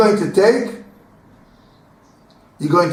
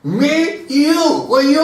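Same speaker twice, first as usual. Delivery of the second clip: escalating bickering; 10 semitones higher, slower than usual. Retold or imitated. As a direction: keep escalating the squabble. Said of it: "If you will speak like this".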